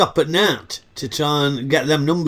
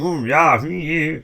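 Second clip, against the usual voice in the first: mock German accent; slower than usual. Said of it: "putting on a sort of German accent".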